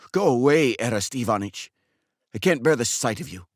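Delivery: a heartbroken man who can't hold it in; a barber sending his customer away in grief; distraught barber Makar. Acted. Barber Makar, grieving and upset.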